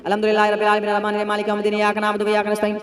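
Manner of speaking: fast, hurried recitation of the verses; rushed recitation. Shown as a demonstration. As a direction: rattle it off carelessly. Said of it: "जल्दी जल्दी".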